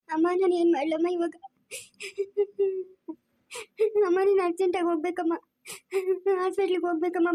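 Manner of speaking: sobbing through the words to her mother; tearful panic. Acted. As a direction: cry helplessly, words broken by sobs. Wife learns of husband's accident.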